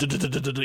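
quick stuttered burst of du-du sounds; imitated rapid-fire explosions. Host describes micro explosives along the grass, imitated rattle. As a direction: rattle off a run of little detonations.

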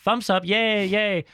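high-pitched smiling cheer, acted out; mock cheering. Imitating the truck driver filming a drag queen, high mock cheering. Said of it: "Så smiler han og sådan".

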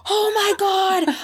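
high, drawn-out mock-overwhelmed cry; retold tearful shock. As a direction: reenact being overwhelmed, stretch it way up high. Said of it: "I'm, like, crying".

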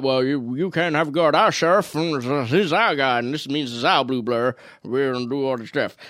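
slurred, hammed-up American townsfolk voice; badly put-on American accent, words running together; mock American accent. Townsfolk defending their sheriff from the government, mock American accent. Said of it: "perfect American accent there".

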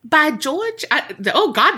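a clumsy mock-English "by George"; hammed-up British accent that falls apart; terrible British accent. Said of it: "that was a terrible accent".

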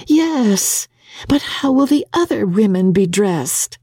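a fretful bride fussing over what to wear; May, fretful young wife. Acted. May presses her question.

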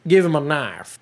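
overdone Southern twang; twangy Southern accent. Retold or imitated. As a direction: lay on a weird, too-twangy Southern accent. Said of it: "in his weird, too much twangy Southern accent".

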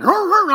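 high, loud imitation barks; dog barking. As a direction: bark back like a dog. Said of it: "I just start barking back".

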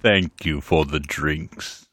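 slow, low, solemn servant voice; deep butler voice. Retold or imitated. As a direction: drag it out, low and grave. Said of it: "And then he would say".

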